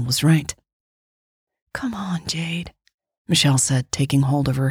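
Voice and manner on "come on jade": hushed urgent whisper. A narrator's reading. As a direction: urge her in a whisper.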